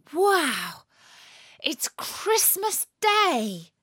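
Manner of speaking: high, drawn-out gasp of delight; excited. A narrator's reading. Natalie waking to Christmas morning, high and excited. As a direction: read it as a thrilled, wide-eyed exclamation. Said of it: "I'm so excited".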